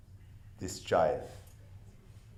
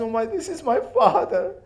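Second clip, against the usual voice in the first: high, louder gasp of recognition; shocked realization; 12 semitones higher, 6 dB louder than usual. Acted. Sakina recognises her father's head, crying out in shock. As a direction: break into it as the truth hits. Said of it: "And then she realized".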